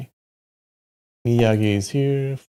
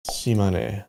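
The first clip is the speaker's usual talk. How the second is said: normal talking voice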